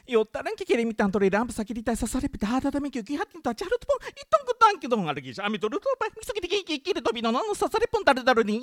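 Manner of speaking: very fast, high, jumpy gibberish; rapid sound poem recital. Acted. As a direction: rattle the nonsense off at speed.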